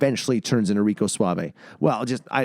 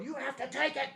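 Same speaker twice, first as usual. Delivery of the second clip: urging director; 7 dB quieter than usual. Retold or imitated. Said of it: "behind me going".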